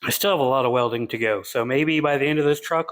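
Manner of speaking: ordinary talk, nothing marked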